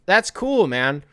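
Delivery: usual speaking voice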